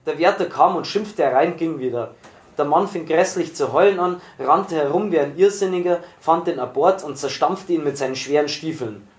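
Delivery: ordinary delivery, nothing marked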